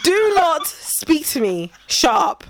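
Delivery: slow, deliberate telling-off; stern refusal, then curt dismissal; mock angry mum. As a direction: space the words out in stern annoyance. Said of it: "I'll be so angry".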